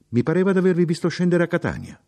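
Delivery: high, quick and loud; animated remark. A narrator's reading. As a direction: pipe up eagerly to a stranger, high and fast. Dialogue opener, high and quick.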